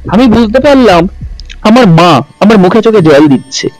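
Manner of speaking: ordinary delivery, nothing marked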